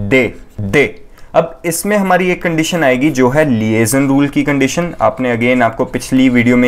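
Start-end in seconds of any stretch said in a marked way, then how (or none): 0.1-0.9 de said short as French de. Shown as a demonstration. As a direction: clear model de for the class. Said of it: "this will be pronounced as". (French उच्चारण में de)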